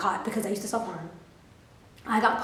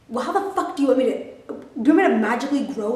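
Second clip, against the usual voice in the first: louder, exasperated retold rant; angry outburst; 3 semitones higher, 7 dB louder, faster than usual. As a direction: vent your fury at the teacher. Retold angry thought at teacher.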